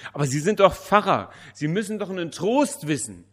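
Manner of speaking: very loud, high desperate insistence; insistent outcry. A narrator's reading. Widow insists the pastor must know comfort, loud outcry. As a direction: hurl it at him, loud and high.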